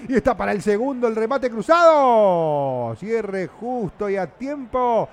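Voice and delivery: loud, stretched-out cry on the shot; big radio football shout, words held long; excited play-by-play. Acted. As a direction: call a near goal with drawn-out words. Live call of a shot on goal.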